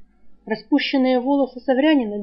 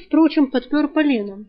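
ordinary delivery, nothing marked

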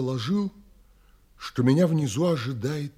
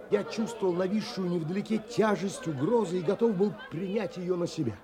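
ordinary talk, nothing marked

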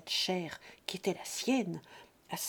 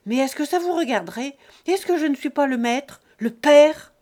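put-on roaring old father, much louder and higher; furious shout; 5 semitones higher, 11 dB louder than usual. Father roaring at son who searched his pockets, furious shout. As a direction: explode with paternal fury. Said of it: "cria avec un furieux geste".

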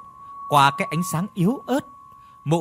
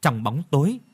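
plain reading voice

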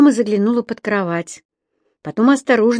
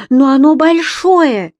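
put-on high, loud, slow child's protest; exclaiming; 7 semitones higher, 7 dB louder, slower than usual. A narrator's reading. Еля objecting to papa about the monster, exclaiming. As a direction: protest as a little girl, drawing it out. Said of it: "воскликнула Еля".

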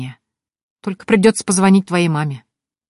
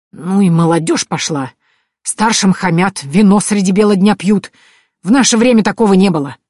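louder, scolding granny voice; grumbling old woman; 3 semitones higher, 5 dB louder than usual. A narrator's reading. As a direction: complain indignantly about the youth. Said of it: "забубнила старуха".

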